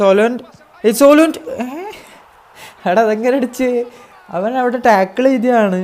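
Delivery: loud, high yelp of surprise; excited disbelief. Real, not acted. Gamer stunned by a lucky goal, loud surprised shout. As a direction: shout it like you can't believe it went in.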